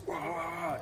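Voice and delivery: high, soft imitated grunts of boxers at work; mock training grunts. Imitating fighters grunting through drills. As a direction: mimic guys grunting as they train.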